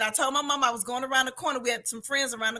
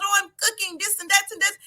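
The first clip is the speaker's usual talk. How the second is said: higher-pitched mock thick Italian accent; 7 semitones higher, faster than usual. Woman imitates the restaurant man, higher mock Italian accent. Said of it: "He had this real thick accent".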